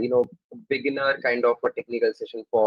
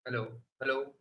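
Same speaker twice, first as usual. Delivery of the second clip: faint call over a weak mic; soft, distant hello; barely audible; 2 semitones higher, 11 dB quieter, faster than usual. Real, not acted. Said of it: "Your voice is very faint here".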